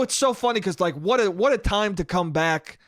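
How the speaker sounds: ordinary talk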